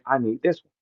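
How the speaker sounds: low and hushed, almost to himself; quiet quoted line. Retold or imitated. Quoting the line as if saying it to Jay Mills, hushed. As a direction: keep it down as a private request. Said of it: "And I'm looking at him saying".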